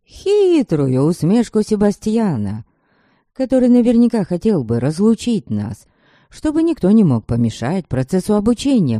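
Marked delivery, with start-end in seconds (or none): none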